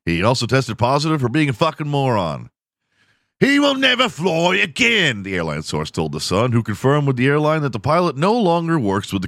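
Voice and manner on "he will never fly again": high mock British declaration; British accent. Comedian quotes the airline source in a British accent. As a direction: say it like a stuffy British official. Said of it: "I need to do a British accent".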